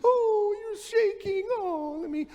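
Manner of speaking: put-on high cooing baby talk to a scared pet; hammed-up pet-owner coo; cooing dog owner. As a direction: mimic a doting owner soothing the dog. Mocking doting dog owners.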